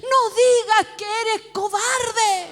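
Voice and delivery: slow, high, loud reproof; forceful rebuke. Retold or imitated. Woman answering the coward's plea, forceful rebuke. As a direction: preach it out as a drawn-out command.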